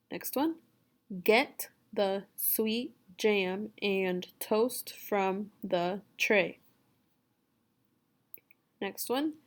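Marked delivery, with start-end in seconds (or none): 1.2-6.6 very slow, each word set apart; word-by-word reading. Shown as a demonstration. Teacher reading for a student to repeat, word by word. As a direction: read it slowly, pausing after every word. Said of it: "You just repeat after me".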